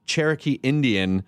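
ordinary talk, nothing marked

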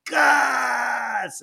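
high, drawn-out cheer; crowd cry. Acted. Goblin horde calling for Gus, drawn-out cry. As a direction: hold the name like a chanting crowd. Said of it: "And everybody goes".

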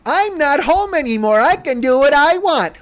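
put-on high, gleeful kid voice; high-pitched childish taunt; mock runaway child. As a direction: crow it like a kid let loose. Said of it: "what you should have just typed".

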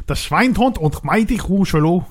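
mock German in a put-on voice; fake German. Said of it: "I can't even do fake German".

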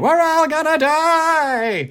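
high, slow panicked scream. Retold or imitated. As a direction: wail it like the world is ending. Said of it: "run around the house screaming".

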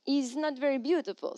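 light French accent on "It's not very beautiful". Shown as a demonstration. Quoting a Paris resident in a French accent. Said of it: "why am I not doing a French?".

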